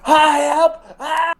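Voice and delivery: high, strained plaintive cry; high choked yelp; strangled cry for help. Acted. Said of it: "You let out, like, this".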